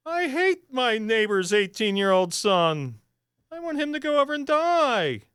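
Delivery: high, mocking, sarcastic emailer voice; mocking angry emailer. Radio host mocking an angry listener's email, high and sarcastic. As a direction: parody a ranting listener in a high voice. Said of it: "angry emails like".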